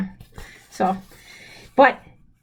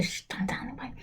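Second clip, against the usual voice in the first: very soft, voiceless aside; secretive whisper; 18 dB quieter, much faster than usual. Pretend. Child reader shares a secret, whispered.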